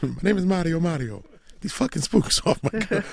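high-pitched attempt at a cartoon Italian accent; Mario. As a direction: put on a hammed-up Italian game-character voice. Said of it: "Just do it in your best Mario accent".

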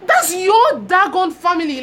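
high, loud, slow outburst at dad; exasperated retort. Retold or imitated. Answering her father's call, an exasperated high slow retort.

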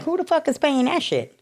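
high, outraged disbelief; incredulous outrage. Retold or imitated. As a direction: shriek the question in disbelief. Retold disbelief at the rent.

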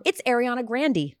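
Grande said GRAN-dee, rhyming with candy; corrected pronunciation. Shown as a demonstration. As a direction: end Grande on "dee", short "a" first. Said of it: "Not Grande".

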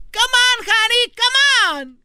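high, breathy-free coaxing falsetto, slow; strippers coaxing. Retold or imitated. Strippers coax the wife onto the stage in a high mock voice. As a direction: play the strippers luring her up.

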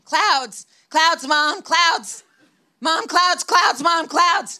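high-pitched imitation of an excited kid's shouts; mock toddler yelling, each word punched out high; toddler shouting. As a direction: pipe up like a small child calling out to Mom. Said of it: "he starts shouting".

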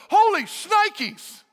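high, slow, stretched-out yelp of shock; startled exclamation. Retold or imitated. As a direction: gasp it out as the frightened servant. Servant sees surrounding army.

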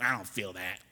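a hint of a churchgoer who won't join the fast song; bored worshipper. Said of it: "We're like".

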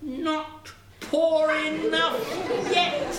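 high, very slow taunt; taunting sparrow. A narrator's reading. Sparrow taunting after third horse dies, drawn-out. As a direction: stretch each word out high.

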